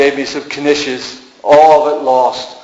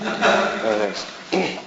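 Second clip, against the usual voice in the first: laughing whisper; 6 semitones higher, 10 dB quieter, much slower than usual. Real, not acted. Laughing aside to audience.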